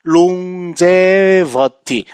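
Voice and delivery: longevity drawn out very slowly, falling at the end; slow model word. Shown as a demonstration. Teacher slowly modelling longevity for students to repeat. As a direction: stretch longevity out syllable by syllable. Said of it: "요거 하나만 좀 기억하실래요?".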